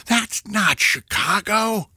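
high, slow mock outrage held in; mock inner scream. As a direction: act out silent disbelief in a stretched voice. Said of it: "you may be screaming".

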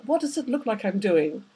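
ordinary delivery, nothing marked

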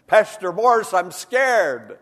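loud, pleading cry of fear, rising high on Pastor Morris; preacher voicing a scared man's desperate plea. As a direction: cry it out like a frightened man begging for help. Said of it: "He told me, he said".

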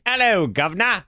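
high, hammy British greeting; mock a lazy stage-British accent; fake British accent. Co-host teasing about a daughter's play, mock British accent. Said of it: "is she just like".

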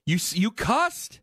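slow, drawn-out mock disbelief; mock shock. Host teasing co-host who cussed, mock shocked. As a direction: act scandalized, teasing.

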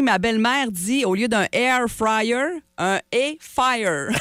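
slowly reading a listener's message. Shown as a demonstration. Host reading a listener's text, slow with a laugh. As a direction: read it slowly, landing on "air fire". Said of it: "quelqu'un qui nous écrit".

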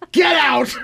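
high, loud mom yell; full-volume imitation of a mother yelling. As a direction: yell it like a startled mom shooing a kid. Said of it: "and then she yelled".

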